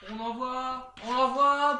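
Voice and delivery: normal talking voice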